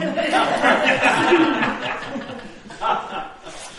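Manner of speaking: a real amused laugh; hearty laugh at own joke; real laughter. Real, not acted. Laugh at toilet roll joke.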